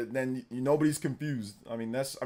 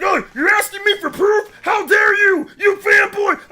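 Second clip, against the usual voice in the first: high, loud hammed-up outrage; mock angry fanboy; 12 semitones higher, 7 dB louder than usual. Streamer mimicking an angry tweeter asked about proof, mock-furious shriek. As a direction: shriek it as a ridiculous offended fan. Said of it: "he got so angry".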